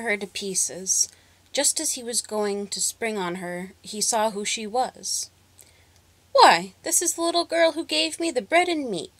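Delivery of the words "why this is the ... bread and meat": high, earnest dog voice; a big dog suddenly kind and thankful; the dog recognises the girl, lightly voiced; grateful dog. A narrator's reading. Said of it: "said the dog".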